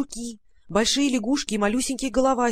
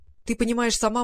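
usual speaking voice

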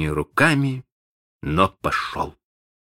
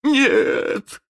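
wailing no. Acted. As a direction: wail it in terror. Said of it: "завопил он".

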